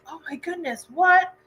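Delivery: high, gasping surprise; mock shock. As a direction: act out a reader's jaw-drop. Retold character's shocked reaction.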